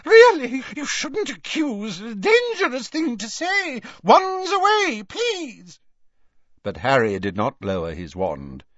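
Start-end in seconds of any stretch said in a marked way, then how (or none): 0.0-5.8 very high, flustered squeal; squealed, alarmed reproof. A narrator's reading. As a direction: plead in a panicky high shopkeeper voice. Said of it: "Madame Malkin squealed".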